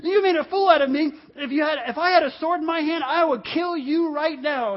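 loud, high, ranting threat; ticked-off Balaam. Acted. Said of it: "he gets so ticked off".

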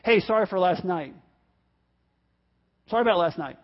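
casual, throwaway sorry; brief, careless mock apology; offhand apology. Shown as a demonstration. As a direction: toss out a token apology. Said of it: "the best response is probably not".

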